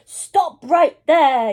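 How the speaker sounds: loud, slow order; commanding yell. A narrator's reading. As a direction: shout it to halt him. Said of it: "yelled Granny".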